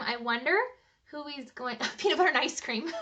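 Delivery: plain reading voice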